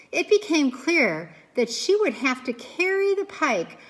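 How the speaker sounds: plain reading voice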